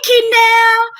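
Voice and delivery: plain reading voice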